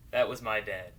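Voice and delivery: normal talking voice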